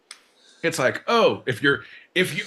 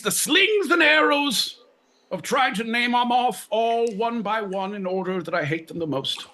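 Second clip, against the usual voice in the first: mock Shakespearean; 5 semitones higher than usual. Parodies Shakespearean verse.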